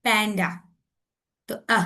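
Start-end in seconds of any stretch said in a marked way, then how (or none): 0.0-0.5 "panda" ending on a lazy uh; schwa in last syllable. Shown as a demonstration. Teacher showing an example word, "panda" with final schwa. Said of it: "वो अ sound निकालता है".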